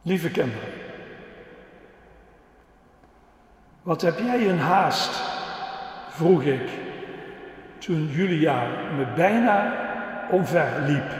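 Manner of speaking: put-on lively storytelling for the kids; reading to children. Preacher telling the children a dog story, lively. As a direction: tell it like a children's story with voices.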